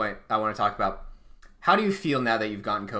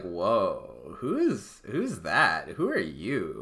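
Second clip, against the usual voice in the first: imitating someone taken aback, pitch swooping; retold astonishment with swinging pitch; startled mock reaction; 3 semitones lower, 4 dB quieter, much slower than usual. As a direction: act out a baffled "who on earth is this".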